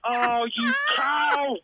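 slow, laughing, crying out; laughing groan. Real, not acted. Prank victim to the presenter, laughing groan. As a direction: drag it out, caught and laughing.

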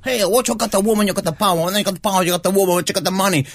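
high-pitched comic Scarface voice; Scarface impression. Warning co-host about powder via film, comic Scarface impression. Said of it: "Just look what happened to Scarface".

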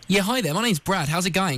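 put-on Australian accent, pitched high. Prank caller introducing himself to reservations, Australian accent. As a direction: greet as a chirpy Australian. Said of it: "doing my Australian accent".

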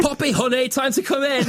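high-pitched northern dad call; calling children in. Acted. Dad calling his daughter in from outside, calling out. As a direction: holler mock-seriously for a child playing outside. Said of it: "I come out and go".

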